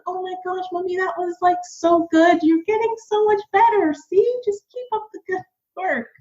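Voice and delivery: put-on high, sweet little boy voice praising mommy; imitating her son. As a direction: pitch way up like a cheering young kid. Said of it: "he came and he was like".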